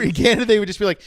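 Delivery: normal talking voice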